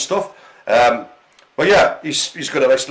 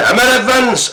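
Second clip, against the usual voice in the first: put-on Welsh accent; 8 semitones higher, 4 dB louder than usual. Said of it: "I have to say Emma Evans in a Welsh accent".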